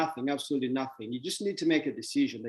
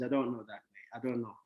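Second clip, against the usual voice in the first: soft dismissive I don't know, mate; quietly mimicking a clueless millionaire; mock clueless shrug; 4 semitones lower, 8 dB quieter than usual. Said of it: "their best answer is".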